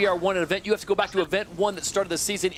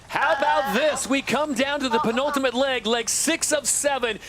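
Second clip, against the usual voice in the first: raised, driving play-by-play build to the decider; live race call; 5 semitones higher than usual. Real, not acted. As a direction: lift the pitch and push the final-leg call. Builds excitement into final leg.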